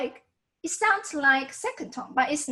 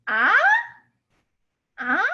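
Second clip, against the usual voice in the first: "Ah" rising like a question; second tone; 5 semitones higher, 7 dB louder than usual. Shown as a demonstration. Teacher demonstrates second tone, rising questioning "Ah". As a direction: lift "Ah" upward in disbelief. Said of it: "that's like the second tone sound".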